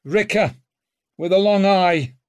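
sour and curt. A narrator's reading. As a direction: answer with a sour edge. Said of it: "very sour".

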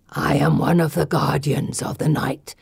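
low, gravelly guardian creature voice; solemn gargoyle. A narrator's reading. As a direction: speak deep, as an ancient stone guardian. Gargoyle names himself to girl.

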